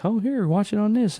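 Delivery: high, eager salesy chirp; chirpy phone voice. Pretend. Phone offering a streaming service to its owner, eager sales chirp. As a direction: play the phone pushing a streaming app, bright and helpful. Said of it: "now it's like".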